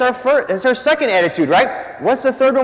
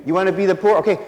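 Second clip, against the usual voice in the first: ordinary delivery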